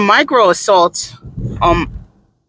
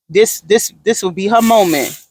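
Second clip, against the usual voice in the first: usual speaking voice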